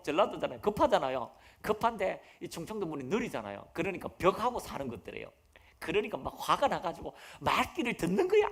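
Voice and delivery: usual speaking voice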